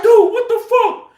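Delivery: high holler of disbelief; retold indignant yell. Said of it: "dog, he hollering".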